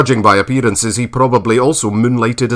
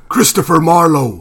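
higher call of the hidden stranger's voice; calling voice from shadows; 6 semitones higher, 3 dB louder than usual. A narrator's reading. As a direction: call his name from hiding. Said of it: "the voice came again".

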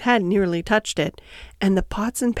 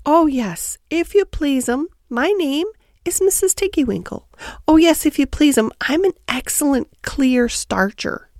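higher-pitched, eager-to-please washerwoman hedgehog; polite, deferential Tiggywinkle; 6 semitones higher than usual. Acted. Tiggywinkle introduces herself to Lucy, polite hedgehog washerwoman. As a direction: bob a curtsy in the voice, high and obliging.

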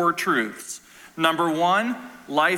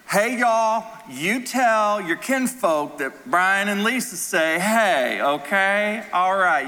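Southern accent; 4 semitones higher, slower than usual. Shown as a demonstration. Preacher showing how he talks to Southerners, in a Southern accent. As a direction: put on a big friendly Southern accent. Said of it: "start talking in a Southern accent".

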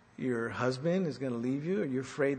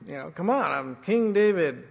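louder, puffed-up royal boast; mock arrogant king; 5 dB louder than usual. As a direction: play the proud king demanding respect. Didn't stand up and say.

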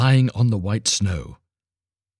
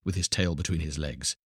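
plain reading voice

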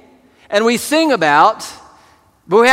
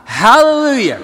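louder hallelujah; 4 semitones higher, 4 dB louder than usual. Real, not acted. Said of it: "one more time".

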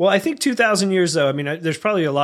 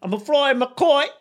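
high Cockney boast; proud Cockney kite flyer; Cockney accent; 10 semitones higher than usual. Acted. Cockney Ben Franklin bragging about his kite. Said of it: "Cockney accents".

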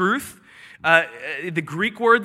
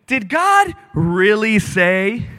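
drawn-out doubting question, rising; devil's sly questioning; 4 dB louder, much slower than usual. Acted. Serpent to the woman, drawn-out insinuating question. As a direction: stretch it slowly, casting doubt on God. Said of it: "this is how the devil lies".

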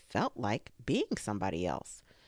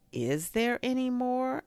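high, rising eager question; quick eager question; 11 semitones higher than usual. A narrator's reading. Bear hoping for more food, eager. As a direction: ask hopefully, voice lifted high. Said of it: "asked Pooh quickly".

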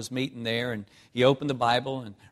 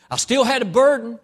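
sudden loud high cry from the pulpit; preaching shout; 11 semitones higher, 10 dB louder than usual. Acted. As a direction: belt it out at the congregation. Preacher climax.

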